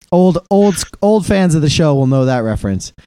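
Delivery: ordinary talk, nothing marked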